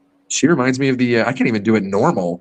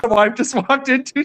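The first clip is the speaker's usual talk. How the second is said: normal talking voice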